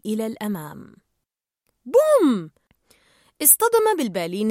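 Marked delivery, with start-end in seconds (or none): 1.8-2.5 loud, high-pitched boom; crash sound word. A narrator's reading. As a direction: punch out the impact like a crash. Narrator voices the crash.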